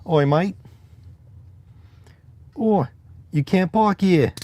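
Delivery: high, loud hammed-up Cockney; bad Cockney accent. Shown as a demonstration. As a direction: bark it like a cartoon Cockney. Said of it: "take the funny ones that they know".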